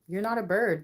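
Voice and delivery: ordinary talk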